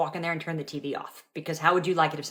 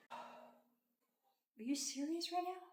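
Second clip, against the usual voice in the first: exasperated disbelief; 12 semitones higher, 15 dB quieter, much slower than usual. Acted. Skit, exasperated mom.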